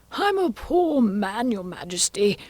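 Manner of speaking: high, slow, pitiful plea; Hatter. Acted. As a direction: beg slowly, starting high and sinking. Kneeling Hatter begs the King.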